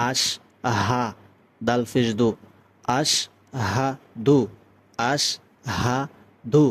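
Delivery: আশ হা দু split slowly into separate beats; syllable by syllable. Shown as a demonstration. Teacher breaking down আশহাদু for learners, syllable by syllable. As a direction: sound each piece of আশহাদু alone. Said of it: "এভাবে বলতে হবে".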